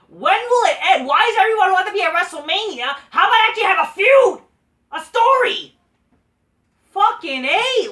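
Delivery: high, loud, straining shout; rising exasperated yell. Real, not acted. Fan ranting at WWE, high loud exasperated yelling. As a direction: cry out at the booking in disbelief.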